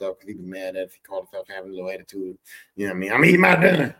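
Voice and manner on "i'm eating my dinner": loud, irritated holler; acted dad shout; annoyed dad yelling. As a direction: bellow it like an interrupted, irritated father. Dad yelling during his meal.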